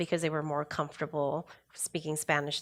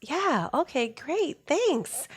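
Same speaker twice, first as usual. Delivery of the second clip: higher, breezy dismissive thanks; mock teen brush-off; 10 semitones higher than usual. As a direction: play the kids humoring mom. Teens' brush-off voiced by mom.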